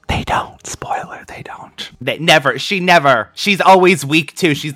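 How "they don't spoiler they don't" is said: secretive spoiler whisper. Acted.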